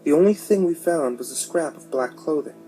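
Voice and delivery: usual speaking voice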